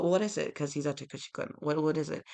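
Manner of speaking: ordinary talk, nothing marked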